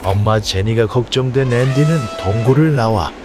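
usual speaking voice

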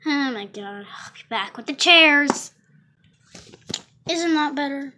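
usual speaking voice

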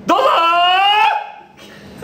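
high, long stretched call to the break; drawn-out call. Acted. Host calling out to cut to the ad break, stretched high. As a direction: sing it out slowly like a cue.

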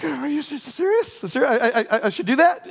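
high-pitched, stammered incredulous questions; stammering disbelief. Retold or imitated. Young minister asking his pastor about the driving job, stammering in disbelief. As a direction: trip over the words in mock nervous surprise. Acted startled reply to pastor.

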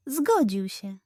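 ordinary delivery, nothing marked

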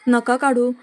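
loud, sudden blurted plea; urgent joint plea. A narrator's reading. Two employees blurt out together, pleading loudly against the firing. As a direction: burst out to stop him. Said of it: "दोघे एकदम बोलतात".

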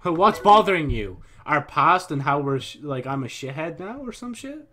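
loud, high demand, rising at the end; mock indignant outburst. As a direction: confront them in hammed-up indignation. Mock confrontation over upset friend.

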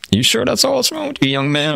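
put-on high, knowing old-lady question; skeptical nurse. Nurse seeing through his excuse, high and skeptical. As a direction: doubt his story in a sweet old voice. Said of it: "And she's like".

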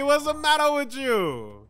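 put-on Italian-American accent. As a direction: throw it back like a riled mobster. Mob stereotype bit, pasta fazool.

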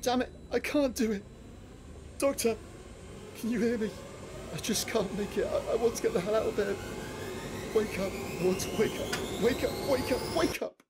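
desperate pleading. Acted. Trapped in the dream, begging the doctor to wake him: frantic panic.

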